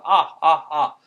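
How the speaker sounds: "Ah, ah, ah" as repeated short sounds; loud, separate open "ah" each time; isolated vowel. Shown as a demonstration. Teacher drills a tricky sound, isolated vowel. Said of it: "ah is a tricky sound".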